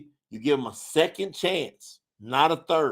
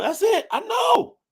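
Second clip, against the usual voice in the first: excited shout. Real, not acted. Preacher cheers a viewer's testimony, shouting high. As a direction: burst out in triumphant assent.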